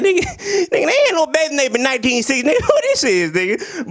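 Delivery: high, laughing mock outrage; laughing disbelief. Reviewer mocking a movie hat, high and laughing. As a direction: crack up calling out the hat. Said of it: "I'm like".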